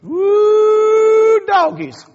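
high whooping "Woo, doggies"; excited whoop. Real, not acted. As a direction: let out a loud country "Woo". Excited exclamation from Kentucky.

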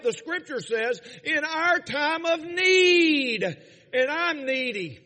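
high, loud revival cadence; impassioned preaching. Real, not acted. Preacher urging the congregation toward grace, impassioned preaching. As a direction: drive it home to the congregation, drawing out key words.

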